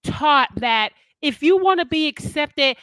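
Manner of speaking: ordinary delivery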